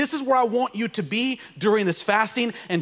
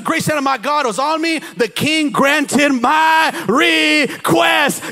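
full-force preaching; 9 semitones higher, 5 dB louder than usual. Acted. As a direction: preach it to the rafters.

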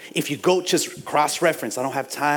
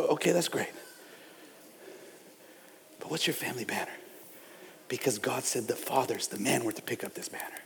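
much softer, probing questions; softer intimate preaching; 4 semitones lower, 11 dB quieter, faster than usual. Real, not acted. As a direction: lean in and ask gently.

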